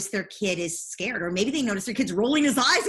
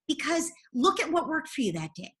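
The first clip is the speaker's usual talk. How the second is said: ordinary delivery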